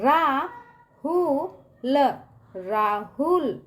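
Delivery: रा, हु, ल separately, then a stretched राहुल; syllable-by-syllable demo. Shown as a demonstration. Teacher models reading for the class, राहुल slow and split up. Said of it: "ऐसे ही पढ़ेंगे".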